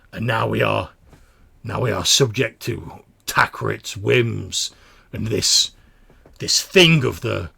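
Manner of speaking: loud, halting, troubled protest; doubting smaller mutant. Acted. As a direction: question your leader haltingly but with force. Voices the smaller mutant's complaint.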